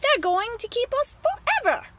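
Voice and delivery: high, loud exclamation; excited cry. Acted. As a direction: cry it out like an eager monkey. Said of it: "cried the monkey".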